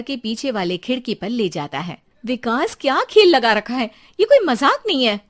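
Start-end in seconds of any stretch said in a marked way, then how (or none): 2.3-5.2 loud, high angry scold. Acted. Wife scolds her husband Vikas, loud and angry. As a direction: snap at him, loud.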